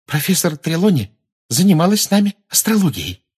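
high, loud eager schoolgirl; excited. Acted. Parvati telling the teacher about astrology lessons, excited. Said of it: "возбуждённо сказала".

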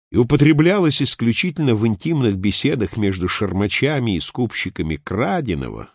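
plain reading voice

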